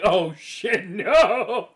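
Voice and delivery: loud panicked yelp; Harry yelping in panic at the tournament; panicked shout. Retold or imitated. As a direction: yell it in sudden panic. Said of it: "he's like".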